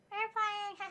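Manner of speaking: high playful call; airplane game. Acted. Student tossing potato skins at Pop, playful airplane call. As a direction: sing it out like feeding a toddler.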